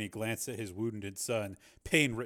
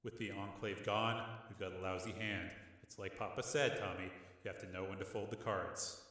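softer steady reasoning; calm; 3 semitones lower, 11 dB quieter than usual. A narrator's reading. Rick calmly reasoning with his brother. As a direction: keep it level. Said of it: "said Rick calmly".

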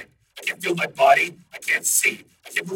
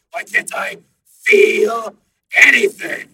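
slow rising to a high shout; rising panic; panicking program; 15 dB louder, much slower than usual. A narrator's reading. Program demanding answers, panicking.

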